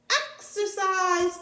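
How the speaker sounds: high, slow announcing call. Acted. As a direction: announce it proudly. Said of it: "called the doctor".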